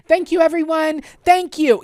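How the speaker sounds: high, loud gushing thanks; celebrity thanks. Retold or imitated. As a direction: thank an adoring crowd like a star. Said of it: "I felt like a celebrity".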